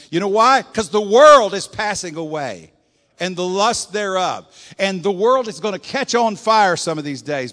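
fiery sermon climax; preaching at full force. Acted. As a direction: thunder it at the congregation. Preacher builds to shout.

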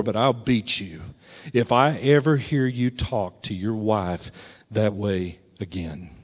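low, soft, measured warning; threatening dad. Retold or imitated. Dad warns son about his wife, quietly menacing. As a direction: drop down and let the warning land. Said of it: "But he said".

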